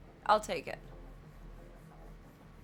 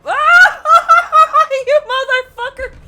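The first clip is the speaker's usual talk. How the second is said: much higher, much louder laughing yell; laughing scream; 22 dB louder, much slower than usual. Real, not acted. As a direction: scream at the game through laughter. Dies in game, screams laughing.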